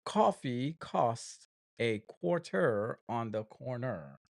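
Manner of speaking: slow, over-enunciated "Coffee costs a quarter on the corner"; drawn-out, fully pronounced R in "quarter" and "corner"; deliberately different. Shown as a demonstration. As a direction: say each word of "coffee costs a quarter on the corner" separately and carefully. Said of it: "I'm gonna say it differently".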